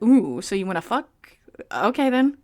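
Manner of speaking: slow, oily mock flirt; mock sleazy come-on. Mocking the man's reply as a slow sleazy come-on. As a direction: ham up a sleazy proposition. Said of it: "his response ... was like".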